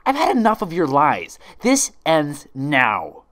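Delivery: loud, slow deadly threat; menacing fury. Acted. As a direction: lay down the final verdict. Twilight threatens the imposter.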